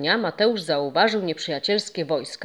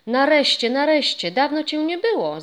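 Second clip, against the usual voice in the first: higher, louder, glad cry of greeting; joyful call; 8 semitones higher, 4 dB louder than usual. A narrator's reading. Ghost greeting the boy after a long absence, calling out gladly. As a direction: call out happily to a long-missed friend. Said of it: "zawołał Boguś".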